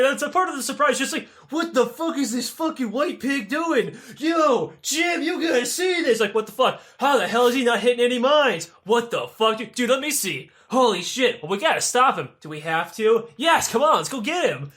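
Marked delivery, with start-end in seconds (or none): 1.5-6.2 hammed-up border guard shouting to a buddy; mock guard shout. Guards spot him through binoculars. 6.4-14.7 higher, louder mock alarm, rapid back and forth; panicky guards. Guards panicking about the defector.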